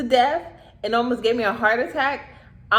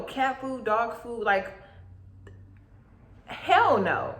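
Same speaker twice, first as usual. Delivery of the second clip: usual speaking voice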